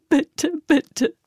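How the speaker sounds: high, loud falling pleas; repeated begging. Acted. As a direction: plead over and over. Terrified girl begs.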